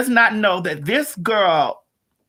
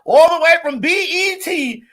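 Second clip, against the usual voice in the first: big rising announcer hype, higher; hype intro; 6 semitones higher than usual. Real, not acted. Host bringing on her guest with big hype.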